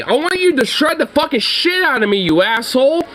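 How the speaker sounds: high-pitched, worked-up rebuke; exasperated goading. Real, not acted. Owner telling his dog to bite him, exasperated goading.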